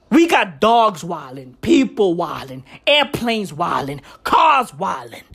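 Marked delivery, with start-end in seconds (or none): none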